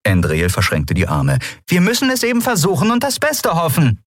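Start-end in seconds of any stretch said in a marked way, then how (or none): 1.7-4.0 high, heated insistence; insistent. A narrator's reading. Captain urging a new search, high and insistent. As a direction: press the point in a high voice.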